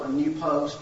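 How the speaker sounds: ordinary talk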